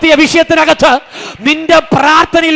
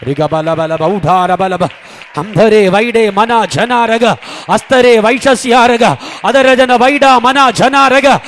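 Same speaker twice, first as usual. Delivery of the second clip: deep prayer in tongues; 4 semitones lower, slower than usual. Real, not acted. Preacher sinking into prayer before the congregation, low slow tongues. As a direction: drop the voice low and let the syllables drift slowly.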